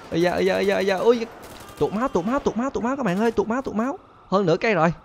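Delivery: very high "tụt máu" cries; alarmed pained cries. Real, not acted. Health dropping fast, high alarmed cries. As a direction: panic as health drains.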